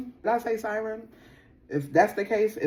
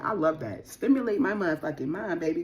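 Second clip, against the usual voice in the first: ordinary delivery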